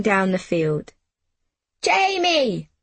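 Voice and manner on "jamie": high-pitched yell, little added volume; narrator's contained excited yell; yelled call. A narrator's reading. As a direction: call out a name, high then falling. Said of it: "I yelled".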